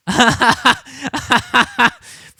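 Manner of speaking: high loud laughter; howling laugh. Real, not acted. Streamer howling at a reply, loud laughter. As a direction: let it burst out.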